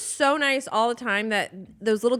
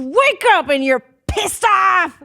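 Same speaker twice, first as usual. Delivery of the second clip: mock-angry outburst; 9 semitones higher, 7 dB louder, slower than usual. Guest explaining bad mornings, mock-angry outburst. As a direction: burst into a loud, higher comic rage.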